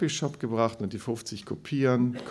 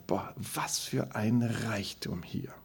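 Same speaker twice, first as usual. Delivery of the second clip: slow, hushed whisper of amazement; awed whisper; 5 dB quieter, slower than usual. Retold or imitated. Pastor marveling at the church members' gifts, whispering in awe. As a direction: breathe it out in quiet wonder.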